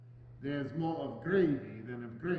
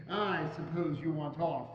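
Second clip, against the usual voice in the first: ordinary delivery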